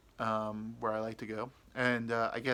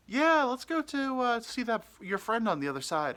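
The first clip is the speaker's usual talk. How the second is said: put-on new girl voice; 12 semitones higher, 5 dB louder than usual. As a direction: stretch it out high and sweet. New girl's voice.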